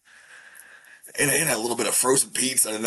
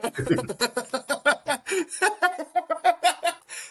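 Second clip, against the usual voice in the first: high-pitched laugh. Real, not acted. After a huge burp.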